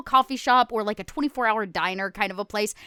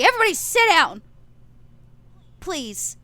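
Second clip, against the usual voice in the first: firm command; 5 semitones higher, 4 dB louder than usual. Acted. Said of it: "and I say".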